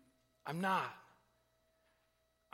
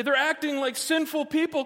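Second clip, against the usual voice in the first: mock upset complaint; 8 semitones higher, 4 dB louder than usual. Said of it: "we can be all upset and say".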